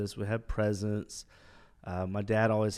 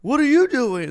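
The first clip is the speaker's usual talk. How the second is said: very high, loud mocking echo; student echoes the cadets, loud mock yell; mocking them back; 13 dB louder than usual. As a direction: throw their question back at them, louder.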